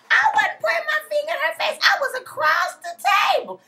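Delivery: high, loud indignant mimicry; mock Mama Joyce. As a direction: whine her denial mockingly. Mocking Mama Joyce's denial.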